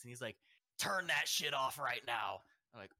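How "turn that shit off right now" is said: slow, loud raspy order. Retold or imitated. Construction worker ordering headphones off, raspy and loud. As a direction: rasp it out as a drawn-out command. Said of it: "in a raspy voice".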